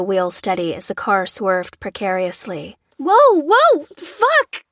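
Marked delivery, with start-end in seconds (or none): 3.0-4.6 high, loud screaming curse; panicked scream. Acted. Lucy screaming while fighting the swerving wheel. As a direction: scream as the car skids. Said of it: "she screamed".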